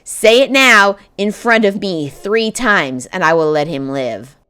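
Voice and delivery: loud, high demanding witch; commanding witch. A narrator's reading. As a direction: order her like a cruel witch.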